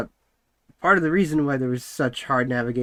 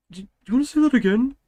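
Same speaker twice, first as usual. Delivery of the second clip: challenging retort; 10 semitones higher, 5 dB louder, faster than usual. Retold or imitated. Hotel worker confronts the speaker.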